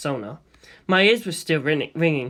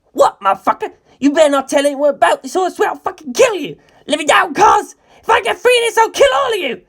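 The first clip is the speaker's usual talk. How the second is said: high, loud, raging threats; high, loud furious teen screaming; 12 semitones higher, 8 dB louder than usual. A narrator's reading. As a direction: scream every threat at the top of the voice. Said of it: "he screamed".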